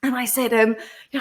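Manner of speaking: ordinary talk, nothing marked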